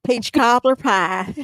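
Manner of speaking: slow drawled "peach cobbler pie" in a mock Southern accent. Shown as a demonstration. Friend echoing the phrase in a slow mock Southern drawl. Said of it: "I'm gonna bake a peach cobbler pie".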